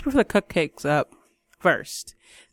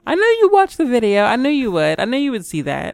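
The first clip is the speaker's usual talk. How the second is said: teasing; 7 semitones higher, 5 dB louder than usual. Real, not acted. Caught, teasing about the video.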